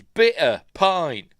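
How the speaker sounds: loud, high, drawn-out pub order; mock Australian-sounding Bristol accent; fake Bristol accent. Second Santa ordering at the bar, loud mock accent. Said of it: "they sound like Australians".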